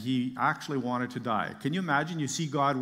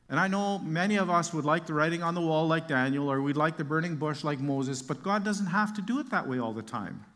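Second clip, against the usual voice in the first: ordinary talk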